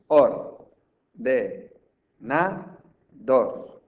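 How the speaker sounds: OR, DE, NA, DOR as four loud separate bursts; or... de... na... dor, strong voice beats; syllable beats. Shown as a demonstration. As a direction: punch each syllable of or-de-na-dor, pausing between. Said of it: "esos son los golpes de voz".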